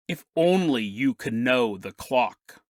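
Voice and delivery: low, slow cryptic voice; mysterious stranger. Acted. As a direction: deliver it slowly and low, like an eerie pronouncement. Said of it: "clear as day, the man go".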